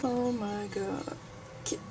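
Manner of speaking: slow breathless whisper; awed whisper. Real, not acted. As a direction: let it out in disbelief. Shocked at slime.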